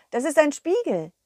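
ordinary delivery, nothing marked

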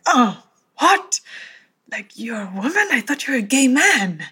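mock surprised man, pitch jumping up; shocked colleague. Retelling the colleague who first met her, mock shock. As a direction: play the colleague's startled disbelief. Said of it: "he was like".

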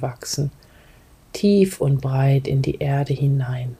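calm guide, sometimes breathy whisper; guided meditation. Acted. Leads a grounding meditation.

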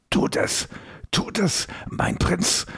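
strained croak, barely voiced. A narrator's reading. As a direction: croak it out through a squeezed throat. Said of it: "krächzte er".

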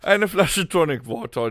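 ordinary delivery

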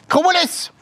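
high shrill call of "Cumulus!"; shouting cashier. Retold or imitated. Migros cashier shouting at the German customer, high call. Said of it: "die dich anschreit und sagt".

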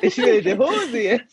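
put-on high, slow little kid voice through laughter; laughing child voice. Recalling a kindergarten kid, high whining through laughter. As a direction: giggle while whining like a small kid. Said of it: "da war ein Kind".

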